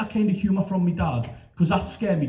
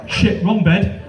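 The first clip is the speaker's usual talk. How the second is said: sudden loud high blurt of alarm; a dad's loud panicked gasp; startled dad; 4 semitones higher, 7 dB louder, slower than usual. Retold or imitated. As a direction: jump up in shock. Dad realises wrong bed.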